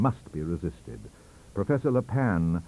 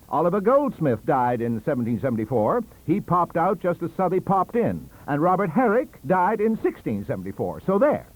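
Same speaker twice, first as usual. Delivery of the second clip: triumphant; 9 semitones higher, 6 dB louder than usual. Acted. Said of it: "said Professor Le Pan".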